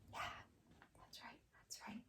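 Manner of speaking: voiceless whispered praise. Real, not acted. Teacher whispering praise to the class. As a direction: murmur the praise under your breath.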